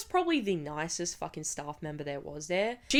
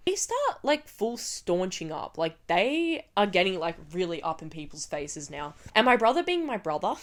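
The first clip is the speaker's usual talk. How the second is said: ordinary talk, nothing marked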